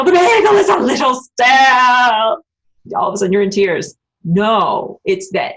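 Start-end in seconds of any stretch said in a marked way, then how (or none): 0.0-2.4 louder, slow, theatrical wail about "bagel"; milk the stale bagel like a disaster; hammed-up tragic complaint. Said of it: "It could be".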